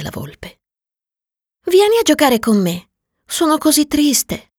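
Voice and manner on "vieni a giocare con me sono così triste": put-on high, young voice; a small boy's higher voice; little prince voice. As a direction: plead gently like a lonely child. Prince invites the fox.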